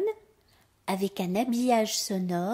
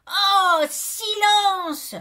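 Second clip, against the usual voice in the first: fed-up shout, drawn out; exasperated yell; 8 semitones higher, 9 dB louder, much slower than usual. A narrator's reading. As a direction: holler to hush the whole house. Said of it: "je hurle".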